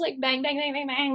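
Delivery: ordinary delivery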